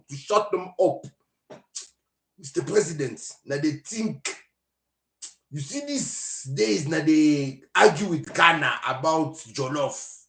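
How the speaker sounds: slow, drawn-out mock Nigerian accent; lightly done Nigerian accent from a leader in a meeting. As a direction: play a Nigerian president musing in a Nigerian accent. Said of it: "imagine me talking in a Nigerian accent".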